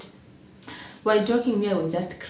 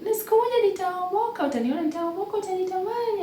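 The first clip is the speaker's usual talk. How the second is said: much higher, fast, playful husband voice; husband's hopeful boast; 13 semitones higher, faster than usual. Retold or imitated. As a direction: tease it like a husband bragging about the future. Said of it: "He was like".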